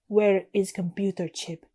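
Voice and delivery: slow, put-on Russian accent. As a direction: question slowly in a badly faked Russian accent. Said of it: "he says in a thick Russian accent".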